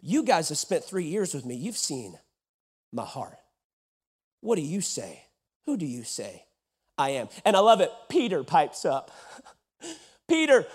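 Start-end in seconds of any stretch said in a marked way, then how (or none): 0.1-7.3 tender quiet question. Acted. Jesus asks disciples intimately.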